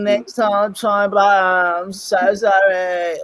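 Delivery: high, slow, whiny mock apology. Mimicking Bruno apologising, high drawn-out whiny sorry. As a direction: drag out a pitiful fake sorry.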